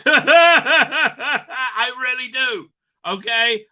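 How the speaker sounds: high, loud and very slow through laughter; laughing insistence. Real, not acted. Streamer laughing while insisting on the prediction.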